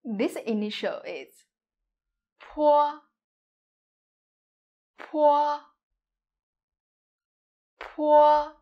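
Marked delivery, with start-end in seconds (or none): 2.4-8.5 "po" with a strong puff of air on the p; breathy aspirated "po". Shown as a demonstration. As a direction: blow air out on "po". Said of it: "Po is aspirated".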